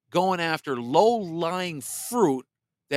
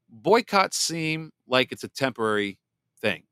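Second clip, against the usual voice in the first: normal talking voice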